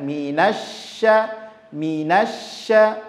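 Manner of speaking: very slow minasya with the sy pressed; pressed shin. Shown as a demonstration. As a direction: lean on the sy of minasya, slowly. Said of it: "Ha, itu dia". (minasya lambat dengan syin ditekan)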